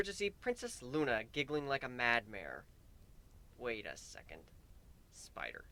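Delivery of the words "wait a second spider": breathless whisper of dawning truth; a hushed realization. Acted. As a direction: whisper it as it dawns. Sudden realization, unvoiced.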